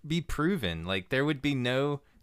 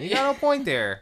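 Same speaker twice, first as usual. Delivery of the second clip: put-on higher, louder Milwaukee accent on "point there"; 13 semitones higher, 5 dB louder than usual. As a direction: ham up a Midwestern Milwaukee local. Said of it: "like, a Milwaukee accent".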